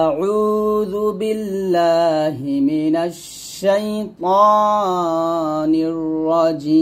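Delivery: slow chanted tajweed recitation, long held vowels; Quran recitation. Shown as a demonstration. Teacher recites the phrase for students in slow tajweed. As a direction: intone it slowly like Quran reading. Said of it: "তাহলে বলতে হবে".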